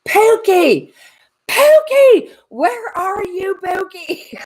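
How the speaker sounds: high, loud calling for a lost dog; Southern woman yelling. Retold or imitated. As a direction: call out for Pookie across the balconies. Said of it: "I hear her yelling".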